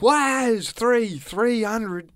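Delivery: high, loud, drawn-out mock protest from the driver; upset driver. Imitating the driver demanding three hundred, mock upset. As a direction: play the wronged driver, slowly and shrilly insisting. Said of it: "he's all upset".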